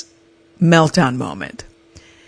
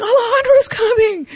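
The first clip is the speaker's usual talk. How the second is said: high, thrilled cry that help is on its way; overjoyed announcement, pitched way up; relieved excitement. Retold or imitated. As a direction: squeal it like rescue has come. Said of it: "I'm like".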